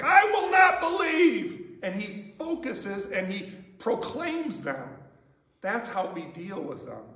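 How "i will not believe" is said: high, loud and slow, each word firm; defiant declaration. Retold or imitated. Thomas refusing the disciples' news, loud and defiant. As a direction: plant your feet and refuse. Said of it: "He's like".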